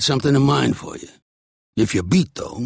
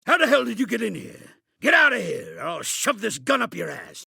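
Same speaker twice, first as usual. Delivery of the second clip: angry threat; 7 semitones higher than usual. Real, not acted. Intruder threatened at gunpoint.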